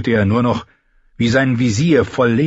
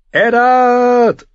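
loud high rallying call; battle cry; 13 semitones higher, 6 dB louder than usual. A narrator's reading. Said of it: "rief eine Stimme".

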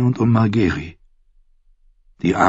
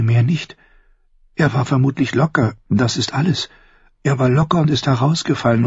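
rising fear; 5 semitones higher than usual. Acted. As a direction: talk herself out of fear, growing frantic. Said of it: "Plötzlicher Schrecken erfüllte sie". (leise beginnend, dann immer höher und hastiger vor Angst)

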